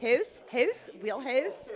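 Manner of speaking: Scottish accent. Shown as a demonstration. Co-host playing with the word after a dialect question, Scottish accent. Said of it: "Can you do a Scottish dialect?".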